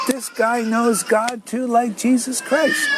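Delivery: high, slow, ringing cry of recognition; awestruck exclamation. Retold or imitated. Man recalls first reading Prabhupada, awestruck high cry. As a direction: call it out like a revelation.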